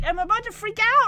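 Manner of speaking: put-on a kid about to freak out; panicking kid. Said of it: "Max is on his way to Panic Town".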